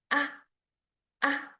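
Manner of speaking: "Ah" kept light and brief; a short, light tap of "Ah"; neutral tone. Shown as a demonstration. Teacher repeating the neutral tone, "Ah" light and short. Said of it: "light and short".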